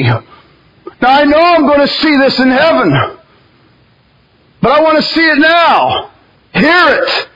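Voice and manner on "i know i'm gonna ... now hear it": preaching at full force. Acted. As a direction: rise into fervent crying out. Preaching builds to shouting.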